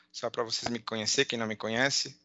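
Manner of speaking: ordinary talk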